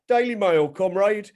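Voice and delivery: loud, high, cheery call of a newsagent; mock newsagent greeting. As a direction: greet him like a jovial shopkeeper. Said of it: "he just goes".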